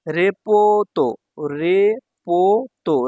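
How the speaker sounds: রেপোতো slow with long vowels; syllable-by-syllable. Shown as a demonstration. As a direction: break রেপোতো into three drawn-out beats. Teacher sounds out new word.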